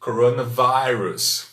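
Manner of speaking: coronavirus with the I said as "ai", slowly; slow careful coronavirus, vai-rus; English coronavirus. Shown as a demonstration. Teacher modelling coronavirus for viewers, slow and clear. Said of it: "só muda a pronúncia aqui do I".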